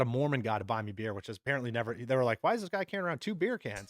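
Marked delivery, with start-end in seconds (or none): 2.4-3.9 imitating baffled bystanders in a raised pitch; higher, wondering mock question; puzzled onlookers.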